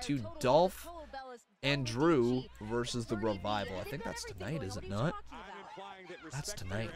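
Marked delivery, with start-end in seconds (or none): none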